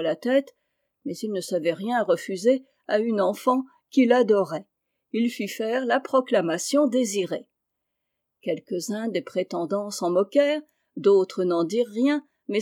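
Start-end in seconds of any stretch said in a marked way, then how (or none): none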